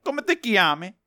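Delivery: lightly mocking his Italian; mocked pronunciation. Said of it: "he says it like".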